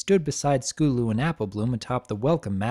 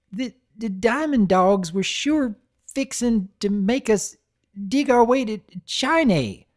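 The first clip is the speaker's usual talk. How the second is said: put-on country filly accent; 8 semitones higher than usual. Voices Applebloom.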